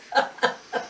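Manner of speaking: breathless laugh. Real, not acted. Laughing at herself.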